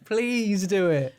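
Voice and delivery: drawn-out, laughing plea; pleading. Real, not acted. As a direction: beg with a long, giggly please. Begging friend to do accent.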